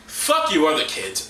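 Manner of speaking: normal talking voice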